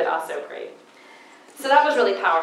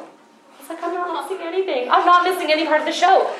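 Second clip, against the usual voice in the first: tearful retelling; 10 semitones higher, 5 dB louder than usual. Retold or imitated. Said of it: "And I'm crying".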